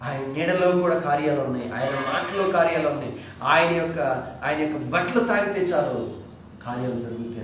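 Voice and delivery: loud proclaiming sermon voice; forceful preaching. Acted. Preacher proclaims Peter's power.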